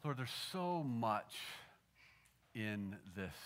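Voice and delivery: low, soft prayer with long pauses; hushed, unhurried pastoral prayer. Real, not acted. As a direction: pray quietly to the Lord, phrase by phrase. Leads a closing prayer.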